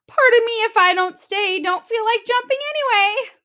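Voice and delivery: put-on high, loud hurried girl voice; Goldilocks. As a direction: apologize while escaping.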